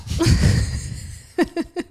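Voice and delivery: breathy laugh at herself. Real, not acted. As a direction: laugh it off, self-amused.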